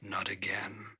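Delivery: hushed, half-voiced grumble; mumbled. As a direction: mumble it softly to yourself. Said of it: "I mumble".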